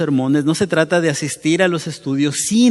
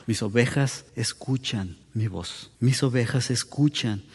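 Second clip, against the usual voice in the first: a hushed, weighty repetition of the verse; hushed solemn quote; 5 semitones lower, 9 dB quieter than usual. Shown as a demonstration. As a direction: let the words of Jesus land quietly. Solemn repeated quote of Jesus.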